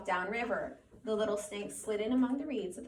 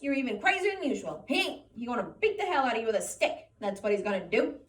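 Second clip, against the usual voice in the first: taunting rabbit; 5 semitones higher, 7 dB louder than usual. Acted.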